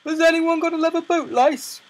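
high, loud public call. Acted. Guard asking all the passengers, loud high call. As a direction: holler the question to a crowd.

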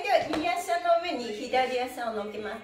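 normal talking voice

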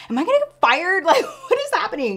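high, laughing panic. Real, not acted. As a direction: laugh through the fear of getting fired. Laughs at own fear of firing.

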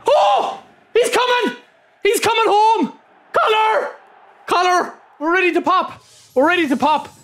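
very high, thrilled shouting; ecstatic. Real, not acted. Streamer thinks Collar is finally in the pack, screaming with joy. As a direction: scream with joy, the big one is coming.

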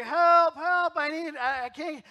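high, loud, desperate cries for help; screaming for help. Retold or imitated. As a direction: yell up from the bottom of the grave. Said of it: "and he's screaming".

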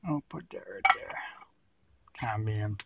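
whispered aside to self; whispering. Real, not acted. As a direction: breathe it out, no voice. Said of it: "whispered aside".

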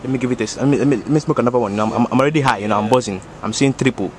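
put-on thick West African accent, easygoing; African uncle accent. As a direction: play a boastful African uncle. Same character voice.

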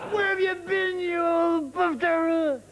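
high, drawn-out boisterous greeting; friendly insults. Acted. Old friends trade insults, jovially boisterous. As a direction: heap mock abuse on a beloved friend. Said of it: "we were actually being friendly".

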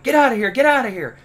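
high, loud shout to scare animals off; shooing shout. Retold or imitated. Shooing the skunks away from his Jeep, loud shout. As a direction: yell it to chase them away. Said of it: "I was like".

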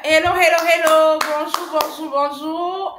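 plus haut et bien plus fort, grand salut d'ouverture; accueil énergique refait. Real, not acted. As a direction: refaire l'accueil en claironnant. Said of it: "on va refaire". (la coach refait son salut au public, haut et très fort)

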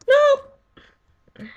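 high piercing shriek of no. Real, not acted. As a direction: shriek in terror. Shriek as mobs attack.

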